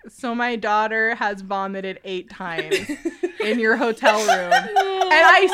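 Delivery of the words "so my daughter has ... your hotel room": low, slow, a touch of an embarrassed dad; mock sheepish father. Father tells hotel manager the bad news, mild sheepish dad voice. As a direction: lightly voice a dad confessing the damage. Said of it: "our father the next day has to be like".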